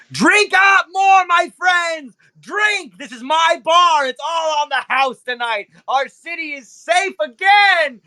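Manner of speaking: rally the whole club like a proud host; boisterous club owner. Acted. Club owner shouting to the crowd, high and booming. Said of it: "and he goes".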